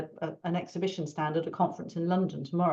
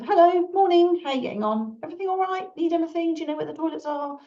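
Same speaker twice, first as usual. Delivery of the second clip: much higher, bright and breezy to passers-by; breezy stand-host welcome, finishing fast; cheery greeting; 10 semitones higher, 4 dB louder, faster than usual. Retold or imitated. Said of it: "it'll just be a case of".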